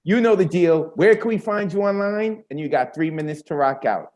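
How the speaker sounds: normal talking voice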